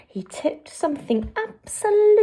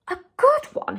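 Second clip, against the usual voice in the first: cried. A narrator's reading. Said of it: "he cried".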